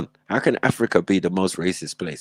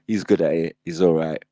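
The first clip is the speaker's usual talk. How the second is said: usual speaking voice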